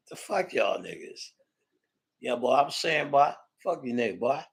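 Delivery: usual speaking voice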